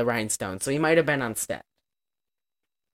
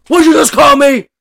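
loud, higher mock indignation; offended retort; 10 semitones higher, 12 dB louder than usual. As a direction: snap back like he's been insulted. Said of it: "He's like".

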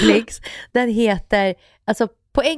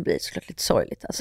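soft whisper; 6 dB quieter than usual. Real, not acted. Unvoiced aside about sad show.

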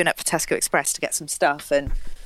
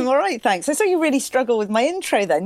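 ordinary talk, nothing marked

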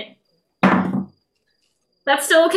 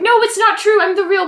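higher, louder denial; protesting; 6 semitones higher, 4 dB louder than usual. Acted. Impostor tells the guards he is the real one, protesting. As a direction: insist on innocence.

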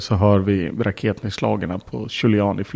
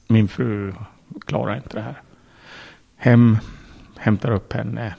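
ordinary talk, nothing marked

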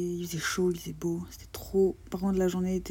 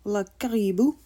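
louder, badly faked Québécois accent; attempted Québécois accent; 6 dB louder, slower than usual. Naming the lake for the camera, badly faked Québécois accent. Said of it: "C'est toujours pas faire l'accent québécois".

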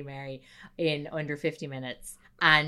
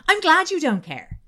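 high and loud hurt retort; shrieking girlfriend; 9 semitones higher, 9 dB louder than usual. Retold or imitated. Girlfriend hitting back at boyfriend, high loud hurt shout.

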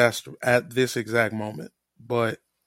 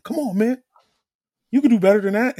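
higher-pitched imitation of yelling; mock yell at a teammate, pitched up high; retold yelling; 10 semitones higher, 5 dB louder, faster than usual. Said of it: "He was yelling at him like".

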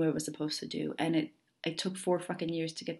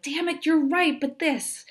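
much higher, exasperated squeal; mock frustration; 10 semitones higher, 4 dB louder than usual. Imagined listener grudgingly admitting a muggle problem, high and exasperated. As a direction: pitch it way up in playful exasperation. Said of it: "you're like".

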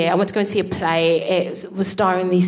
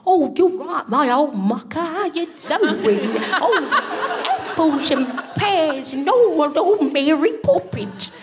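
high, hammed-up mock Cockney; cartoonish Cockney chimney-sweep squawk; put-on Cockney; 7 semitones higher, slower than usual. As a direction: overdo a cheery Cockney greeting, pitched way up. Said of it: "They put on a Cockney accent".

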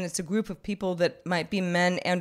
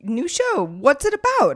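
excited friend; 11 semitones higher, 3 dB louder than usual. Retold or imitated. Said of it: "a friend of mine was like".

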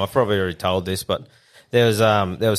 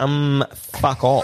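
drawled dismissive retort; 3 semitones higher, much slower than usual. Retold or imitated. His drawn-out reply to the neighbour.

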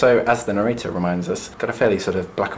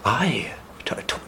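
halting, whispered stammer; mock-hesitant whispered testimony; Reverend Paris; 5 dB quieter than usual. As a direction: stumble over the first words in a hushed whisper. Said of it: "Paris is like".

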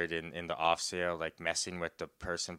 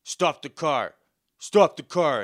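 shouted order; 6 semitones higher, 9 dB louder than usual. Retold or imitated. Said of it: "and he's like".

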